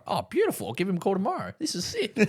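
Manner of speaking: high-pitched delighted retelling; excited. Retold or imitated. Trainee told he gets free shoes, excited. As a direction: replay your giddy delight, pitch climbing. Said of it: "I was really excited and happy".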